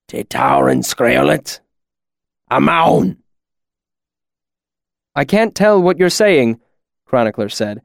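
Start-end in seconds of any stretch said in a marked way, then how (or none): 0.1-3.2 slow, menacing demand rising at the end; angry otherworldly mercenary. A narrator's reading. Mercenary demands an answer from Chronicler, growing angry. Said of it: "grew angry". 5.2-6.6 higher-pitched character voice, mildly agitated; uneasy scribe. A narrator's reading. Chronicler answers the angry mercenary, uneasy protest. Scribe confronts threatening stranger.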